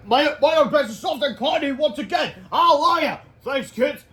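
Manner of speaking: high, loud triumphant cheer; cartoon hero joy. Hero rejoices.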